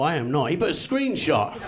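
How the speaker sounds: ordinary delivery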